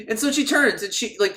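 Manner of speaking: normal talking voice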